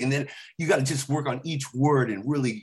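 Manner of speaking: ordinary talk, nothing marked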